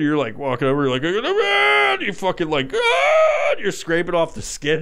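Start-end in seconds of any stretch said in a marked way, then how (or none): 1.0-1.9 a high, strained mock cry of pain; imitated pained yelp; mock scream. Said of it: "you're, like, screaming". 2.7-3.5 a very high, loud mock shriek of pain; hammed-up agonized scream. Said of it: "you're, like, screaming".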